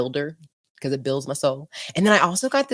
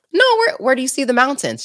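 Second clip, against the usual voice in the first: higher-pitched mock puzzlement; retold bafflement; 8 semitones higher, faster than usual. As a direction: act out the baffled group answering mom. Puzzled group voiced in the story.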